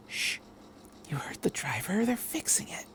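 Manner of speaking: soft unvoiced whisper; hushing whisper. A narrator's reading. Mother hushing her little son in the dark, whispered. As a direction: shush the child and whisper to calm him.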